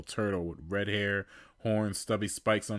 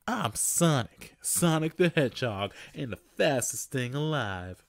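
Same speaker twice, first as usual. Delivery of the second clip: cocky Sonic; 6 semitones higher, 4 dB louder than usual. Acted. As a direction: show off your speed. Sonic introduces himself cocky.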